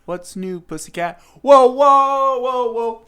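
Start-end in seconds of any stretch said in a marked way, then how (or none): 1.4-3.0 loud high alarmed whoa; startled whoa. Real, not acted. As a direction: jump back, startled. Startled exclamation.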